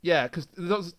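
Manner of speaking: ordinary talk, nothing marked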